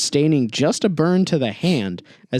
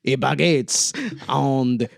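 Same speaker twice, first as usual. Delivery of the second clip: very drawn-out cartoon French; 2 semitones lower, much slower than usual. Shown as a demonstration. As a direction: stretch it out in a hammed-up French accent. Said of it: "a really stereotypical sound followed by".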